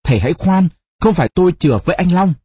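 urgent, pleading protest; hurried crying out. A narrator's reading. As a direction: rush the words out to stop a beating. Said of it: "vội đứng lên kêu".

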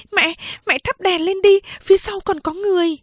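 put-on high, hurried girl's voice; urgent young daughter. Second sister urging mother to light the lamp, high and urgent.

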